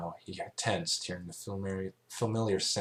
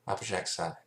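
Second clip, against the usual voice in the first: usual speaking voice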